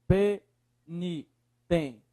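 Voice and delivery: "penitenciário" in slow, separated syllables. Shown as a demonstration. Teacher separating the word for students, syllable by syllable. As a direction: split "penitenciário" with a pause after each syllable. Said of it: "separa a palavra".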